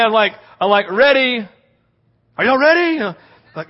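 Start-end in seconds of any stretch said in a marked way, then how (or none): 0.9-3.0 high, loud countdown call; rally the kids before falling back. Retold or imitated. Calling out before the trust fall.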